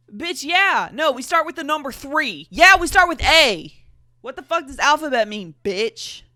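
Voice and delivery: loud, high mocking retort; sarcastic yelling. Real, not acted. Yelling sarcastically at the narrator's dumb question, loud and high. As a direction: bark it back at the dumb question.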